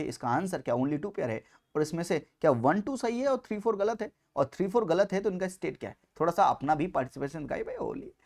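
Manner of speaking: normal talking voice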